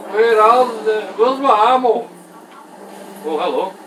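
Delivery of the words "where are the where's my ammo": frantic call. Real, not acted. Gamer panicking for ammo.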